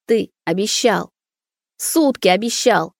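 slow, tearful accusation; sobbing. Acted. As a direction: sob it out as a reproach. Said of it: "всхлипывала Маруся".

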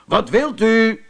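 high, slow, drawn-out shout. A narrator's reading. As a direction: shout it down to a stranger outside. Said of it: "schreeuwde Ben".

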